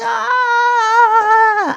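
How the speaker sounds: high sharp scream of pain; loud high pain yelp. Retold or imitated. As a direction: cry out as the nerve hits. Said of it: "I was screaming".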